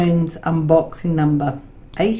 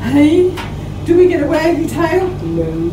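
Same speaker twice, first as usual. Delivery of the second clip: high, loud, bright call to the dog; excited pet talk; 11 semitones higher, 5 dB louder than usual. Real, not acted. Asking her grumpy dog for a tail wag, excited pet talk. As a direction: burst out playfully high and loud.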